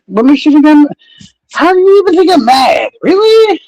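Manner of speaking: high, sarcastic disbelief; sarcastic mock outrage. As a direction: play at being wounded, all in jest. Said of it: "me being sarcastic and joking around".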